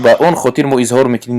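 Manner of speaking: ordinary talk, nothing marked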